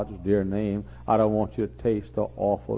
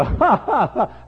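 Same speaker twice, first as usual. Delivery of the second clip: mocking laugh; 8 dB louder, slower than usual.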